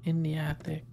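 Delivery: whispered ghost reply; ghostly whisper. Pretend. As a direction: breathe it like a hidden spirit. Answering ghost question as ghost.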